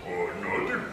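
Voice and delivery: low breathy dumb-giant mumble; dopey Hulk. Retold or imitated. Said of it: "Hulk sounds like this".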